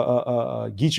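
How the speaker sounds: ordinary talk, nothing marked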